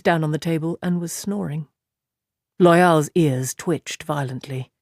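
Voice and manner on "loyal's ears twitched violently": usual speaking voice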